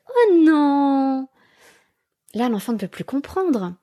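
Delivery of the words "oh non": high, sweet little no with a smile; cutesy baby voice. Shown as a demonstration. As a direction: coo it to a toddler. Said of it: "avec une petite voix toute mignonne et un grand sourire".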